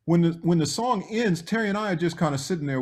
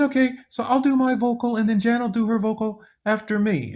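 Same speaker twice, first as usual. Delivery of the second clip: Michael impression; 8 semitones higher than usual. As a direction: lift the voice into a gentle high Michael. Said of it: "He said".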